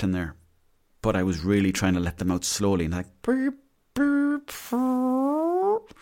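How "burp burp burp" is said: high squeaky mock fart sounds, drawn out; playful mouth-made fart imitation; imitated fart noises. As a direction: squeak out each little fart like a held note. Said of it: "that kind of stuff".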